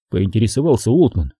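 ordinary delivery, nothing marked

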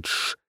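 quiet, tender shh; soft shushing. A narrator's reading. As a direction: hush him gently. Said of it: "заботливо приговаривая". (заботливое тихое «тш»)

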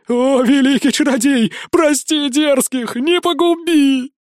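high, slow pleading; begging guards. A narrator's reading. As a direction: grovel for mercy, wailing. Upside-down guards beg mercy. (высокая, медленная мольба о пощаде)

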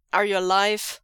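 loud, clipped and impatient; sharp impatient demand. A narrator's reading. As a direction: bark it at him, fed up. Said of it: "demands, clipped and impatient".

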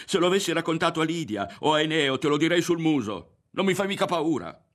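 high, loud, heated retort; angry defiance. A narrator's reading. Ario tells Berto he isn't afraid, defiant shouting.

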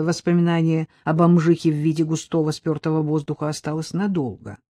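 plain reading voice

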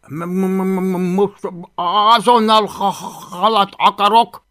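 loud, high stuttering little dragon; stammering dragon demand. Acted. Hungry dragon demands fish from boy, stammering insistently.

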